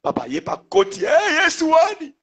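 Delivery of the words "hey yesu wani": sudden high, loud shout; imitating a startled cry to Jesus; acted outcry. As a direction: burst out shouting like the man praying. Acting the man calling out in prayer.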